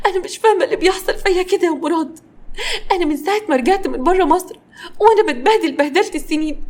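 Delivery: high, tearful put-on wail; hammed-up sobby complaint, pitched high; fake crying. A narrator's reading. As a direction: play the weepy victim to your husband. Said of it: "كارمن بدأت تعيط بتمثيل".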